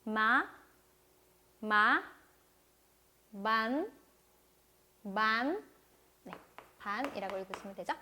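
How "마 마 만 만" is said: 마 and 만 each gliding upward; light rising tone. Shown as a demonstration. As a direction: lift the end of 마 and 만 like a question. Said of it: "가볍게 올렸습니다".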